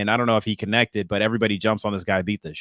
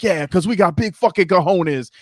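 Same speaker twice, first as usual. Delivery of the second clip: higher hammed-up boast; hyped performer; 8 semitones higher, 3 dB louder than usual. Spoken-word performer boasting to crowd, high hammed-up voice. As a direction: brag to the open mic crowd. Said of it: "he's like".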